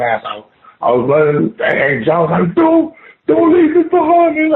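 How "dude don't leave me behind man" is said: high-pitched mock holler; retold panicky cry, pitched way up; panicked plea. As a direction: imitate a friend begging not to be left. Friend's retold plea while fleeing.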